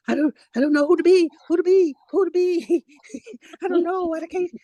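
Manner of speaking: high, fast, panicky mock voice; imitating a frantic friend; frantic imitation. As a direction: play her flustered and lost. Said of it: "The other thing I heard is ... You so frantic".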